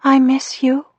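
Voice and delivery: put-on high-pitched, wistful little girl; sad childish voice. A narrator's reading. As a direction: sound like a small child missing her parents. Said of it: "Her childish voice through the speaker sounded so sad".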